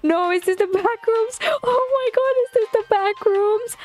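high, rising panic at the dark rooms; real alarm, voice jumping up high; scared disbelief. Real, not acted. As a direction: freak out as the lights die. Panics on entering dark backrooms.

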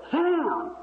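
high, stretched-out mock holler; drawn-out, high-pitched retold yell; imitated heckler's yell. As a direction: holler it like someone across a crowd. Someone in crowd yells at him.

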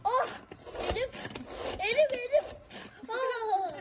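high, loud yelp of pain; pained cry. Real, not acted. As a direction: cry out as if burned. Kid hurt by hot oil. (acı içinde bağırarak)

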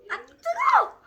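sudden high yelp, burned; hot food yelp. Real, not acted. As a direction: cry out from the heat.

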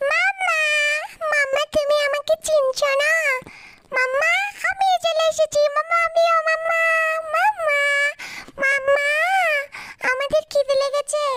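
put-on high, small baby voice crying for mamma; a tiny hungry baby mouse calling its mother. Baby mice calling Jerry mamma.

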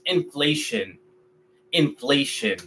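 inflation softly, in-flay-shun; English pronunciation. Shown as a demonstration. Teacher modeling English for students, softly. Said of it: "en inglés".

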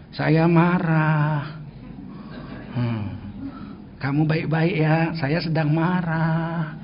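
very slow, soft voice holding anger down; calmly restrained anger. Retold or imitated. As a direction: say it slowly and quietly, keeping the anger in. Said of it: "Marah dia, cuman gemeter aja gitu". (pelan dan lirih, menahan marah)

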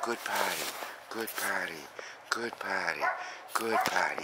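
very soft, falling praise; quietly approve, over and over; gentle praise. Real, not acted. Trainer praising dog for going potty, soft and gentle.

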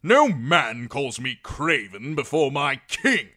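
loud, high, slow indignation; near shout. A narrator's reading. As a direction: hurl it out, each word weighted. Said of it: "Sir Axel all but shouted".

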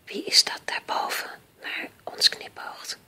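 hushed, voiceless whisper; very soft night-time whisper. A narrator's reading. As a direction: whisper a wondering question. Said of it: "fluisterde Joris".

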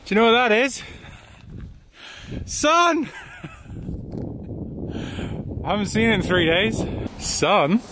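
thrilled, high pitched calling out; overjoyed at seeing the sun; excited. Real, not acted. Sun appears after days of rain.

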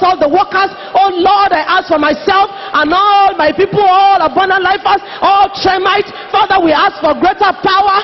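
forceful, chant-like pleading in repeated phrases; impassioned corporate prayer, rhythmic and unrelenting; fervent prayer. Real, not acted. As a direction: plead insistently with God for the church. Prays aloud with repeated pleas.

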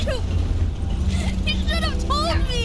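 loud, high, hurt shout; angry accusation. Acted. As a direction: shout the reproach at your brother. Boy accuses brother.

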